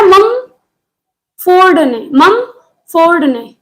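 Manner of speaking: Mumford ने said slowly and deliberately; a teacher stressing the answer; slow dictation. Shown as a demonstration. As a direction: reveal the name slowly so it sticks. Teacher revealing the author's name.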